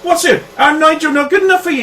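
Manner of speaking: high, indignant scolding; jealous accusation. Acted. Local girl confronting stranger over Nigel, high angry challenge.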